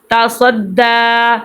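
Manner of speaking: تا صد دا with the final "daa" held long; lengthened vowel. Shown as a demonstration. Teacher showing learners how to lengthen letters, drawn-out vowel. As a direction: stretch the last vowel of تا صد دا. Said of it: "حرکات کو لمبا کریں گے".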